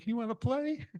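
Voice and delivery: small and guileless, just wanting to play; kitten voice. Acted. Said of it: "the little kitten's going".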